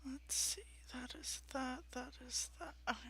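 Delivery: whispering. Real, not acted. Muttering to herself checking sheet.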